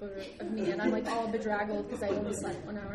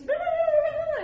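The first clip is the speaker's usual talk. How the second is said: very high, loud babbled yelling; mock tirade; 14 dB louder than usual. Airline lady yelling back at security, high mock tirade. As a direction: rattle off a shrieked nonsense rant.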